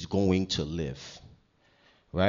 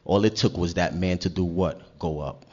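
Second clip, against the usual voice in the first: ordinary talk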